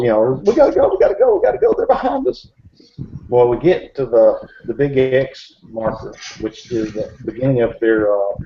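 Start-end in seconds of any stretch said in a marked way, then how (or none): 0.4-2.5 very high, urgent mock panic; still panicking. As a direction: imitate a frantic man begging to flee. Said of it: "and he's still".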